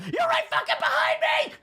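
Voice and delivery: high laughing shriek of fright; scared shout. Retold or imitated. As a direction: yelp in mock terror, laughing. Playing Slender Man, note found.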